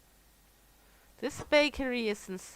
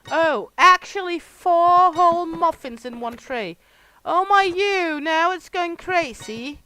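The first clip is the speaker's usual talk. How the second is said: mock amazement; 8 semitones higher, 15 dB louder than usual. Mock amazement at muffin tray.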